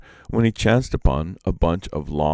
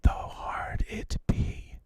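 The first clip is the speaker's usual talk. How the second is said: whisper; 14 semitones higher, 9 dB quieter than usual. A narrator's reading. Peacock whispering the condition into Iktomi's ear, hushed whisper. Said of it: "the peacock whispered".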